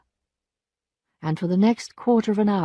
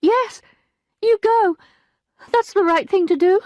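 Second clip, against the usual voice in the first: high, loud servant's voice; the maid breaking bad news, high and loud; distressed maid; 13 semitones higher, 6 dB louder than usual. A narrator's reading. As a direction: cry it out as the upset maid. Maid's voice.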